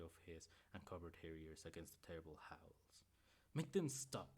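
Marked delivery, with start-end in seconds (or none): 3.5-4.3 pleading cry to make it stop; desperate plea, raised. A narrator's reading. Mother begging Robb to stop the howling, crying out. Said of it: "she cried".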